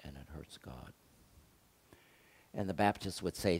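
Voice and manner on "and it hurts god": much softer, almost under the breath; hushed repeat. Real, not acted. Preacher repeating to the congregation, hushed and heavy. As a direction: let "And it hurts God" sink to a hush.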